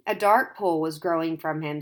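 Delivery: ordinary delivery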